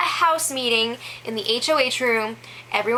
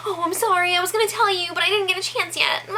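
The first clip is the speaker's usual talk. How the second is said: higher, mock-sorry girly apology; 7 semitones higher, faster than usual. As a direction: imitate her sheepish apology, pitched up. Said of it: "like, apologizing to him".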